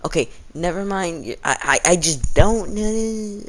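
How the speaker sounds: high, sulky brush-off, dragging slowly at the end; with attitude. Retold or imitated. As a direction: retell a classmate's sassy reply. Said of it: "Anna started giving her an attitude".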